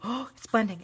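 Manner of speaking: ordinary delivery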